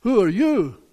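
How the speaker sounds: mildly incredulous, with a long pause before asking twice; startled neighbor. Acted. Neighbor to risen Lazarus, taken aback. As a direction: act a neighbor who can't place him. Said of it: "his neighbor says".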